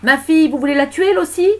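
high, loud outraged question; anguished, accusing yell; crying out. Acted. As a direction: shout it as a desperate mother. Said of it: "cria Gilda".

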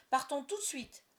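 high, loud urging; boy eager for adventure; eager shout. A narrator's reading. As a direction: urge everyone to leave now. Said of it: "cria John".

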